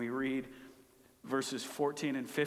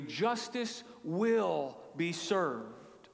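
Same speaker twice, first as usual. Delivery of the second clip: usual speaking voice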